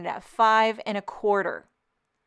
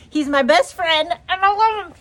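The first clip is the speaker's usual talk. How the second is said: higher, louder gushing sweetness; doting pet voice; 8 semitones higher, 7 dB louder than usual. Real, not acted. Crafter gushing about her dog Hank, higher doting voice. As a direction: coo it like you adore him.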